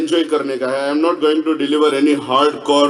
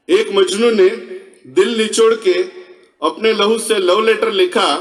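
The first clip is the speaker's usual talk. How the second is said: measured stage recital with held pauses between phrases; verse recitation; 4 semitones higher than usual. Acted. Speaker recites a Majnu love-letter verse to the audience, poetry recital.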